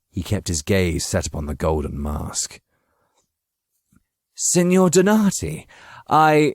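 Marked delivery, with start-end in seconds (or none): 4.3-6.5 high, slow, tense address; nervous greeting. Acted. Bowing Machiavelli greets the masked figure, high and tense. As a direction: greet her while fearing danger.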